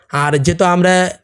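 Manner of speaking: ordinary delivery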